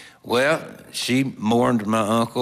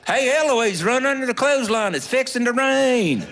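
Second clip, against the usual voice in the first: high, imitated kid's holler; mock shouted warning, pitched way up; playground yell; 13 semitones higher than usual. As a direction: call out across a schoolyard. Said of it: "somebody'd yell".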